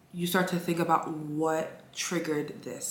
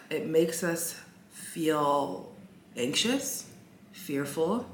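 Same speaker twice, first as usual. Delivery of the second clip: slow and heavy, letting it sink in; drawn-out, deliberate delivery; much slower than usual. Acted. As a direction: stretch it out, each word lingering. After a sigh, drawn out slowly.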